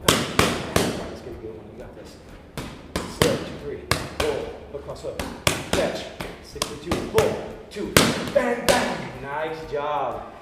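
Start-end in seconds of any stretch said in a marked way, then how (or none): 0.1-9.2 calling combos. Shown as a demonstration. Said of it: "slow it down a little bit".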